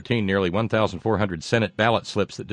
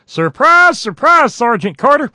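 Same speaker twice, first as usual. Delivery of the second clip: much higher, louder hillbilly twang, drawn out; Gomer Pyle style catchphrase; 11 dB louder, slower than usual. Retold or imitated. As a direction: crow it like a goofy Southern private, much higher. Gomer Pyle catchphrase to Sergeant Carter.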